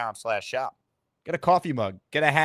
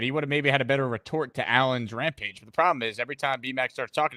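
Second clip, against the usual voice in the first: normal talking voice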